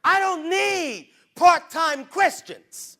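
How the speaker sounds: slow, loud, high declaration; preaching shout. Real, not acted. Preacher rebuking the church, slow loud shout. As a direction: hammer every word slowly.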